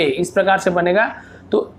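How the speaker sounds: ordinary talk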